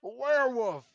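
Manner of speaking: high, loud panicked cry; not Roy's voice. Acted. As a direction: shout the discovery in horror. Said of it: "That was not Roy's voice at all".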